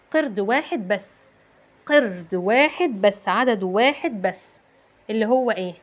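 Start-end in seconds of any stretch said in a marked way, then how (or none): none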